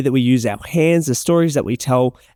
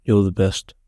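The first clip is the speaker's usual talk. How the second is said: sad; 5 semitones lower, slower than usual. Shown as a demonstration. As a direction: let it sink, like it hurts to say. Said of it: "in a sad way?".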